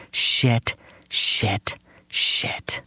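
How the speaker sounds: fading whispered curses; mother muttering in dismay, fading to a whisper; repeated whisper. Retold or imitated. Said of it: "my mother whispered".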